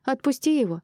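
high, urgent command; crying out. A narrator's reading. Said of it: "крикнула Мира".

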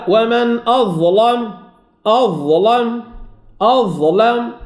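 darkened lam in "أظلم"; heavy lam in recitation. Shown as a demonstration. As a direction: chant "أظلم" with a thick, dark L. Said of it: "فهي مفخمة كذلك".

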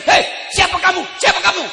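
startled high shout at an intruder; kaget. Acted. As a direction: call out sharply in alarm, pitched well up. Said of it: "Kaget suami". (berseru kaget)

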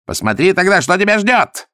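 very high, loud challenge; snarling threat. A narrator's reading. The Tibetan shows Zagorsky what awaits him, snarling. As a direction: threaten with rising fury. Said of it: "оскалился тибетец".

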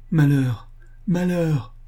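high-pitched wail of alarm; distraught cry. A narrator's reading. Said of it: "cria la voix du boucher".

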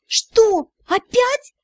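high, outraged disbelief; indignant. Acted. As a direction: protest with full indignation. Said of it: "Возмущения пределу не было".

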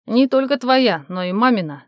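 ordinary delivery, nothing marked

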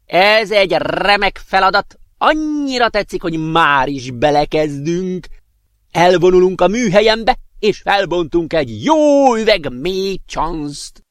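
high, loud, drawn-out character voice; exuberant professor. A narrator's reading. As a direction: stretch the words with big, delighted enthusiasm.

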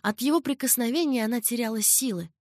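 ordinary delivery

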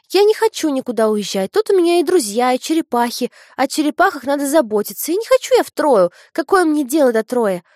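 высокий громкий детский голос; упрямая девочка спорит; капризный ребёнок. A narrator's reading. Дочь спорит с матерью. (капризный детский голос)

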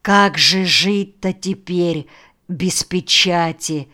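ordinary delivery

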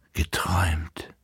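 slow unvoiced whisper. A narrator's reading. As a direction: whisper it waking up. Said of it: "flüsterte sie". (langsam geflüstert)

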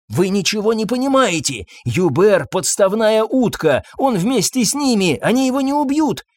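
high, flustered voice; agitated insisting. A narrator's reading. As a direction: argue frantically, pitch up. Husband frantically insists.

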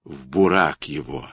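usual speaking voice

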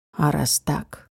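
low, velvety menace; low sensual voice. Acted. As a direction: drop into a seductive low register. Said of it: "таким низким, чувственным голосом".

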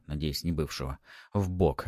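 ordinary delivery, nothing marked

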